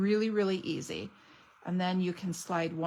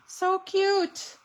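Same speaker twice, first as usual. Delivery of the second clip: gushing; 10 semitones higher, 5 dB louder than usual. Real, not acted. Gushing over stamps.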